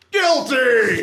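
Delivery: high, drawn-out mock yell; imitated family yell. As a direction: mimic relatives yelling at the TV. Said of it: "would simultaneously all yell".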